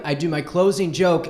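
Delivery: ordinary talk, nothing marked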